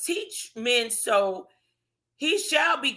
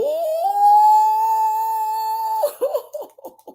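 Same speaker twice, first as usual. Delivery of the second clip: big laugh; 9 semitones higher, 6 dB louder than usual. Real, not acted. Preacher cracks up at scripture.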